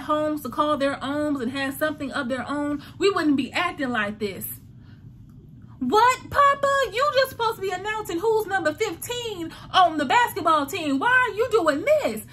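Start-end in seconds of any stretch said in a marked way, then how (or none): 5.8-12.2 high, loud indignant protest; outraged crowd. Retold or imitated. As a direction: voice the offended crowd calling him out. Crowd's outraged reaction, voiced.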